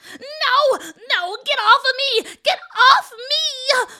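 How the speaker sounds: high, loud protesting screams; screaming. A narrator's reading. Old woman being shoved by the children, screaming. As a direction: yell as if fighting off attackers. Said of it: "she screamed".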